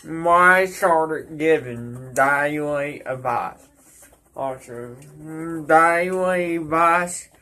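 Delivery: tobacco packed behind lip; talking around lip wad. Real, not acted. Seen 2: Lower lip bulges; he spits into a spittoon before and after.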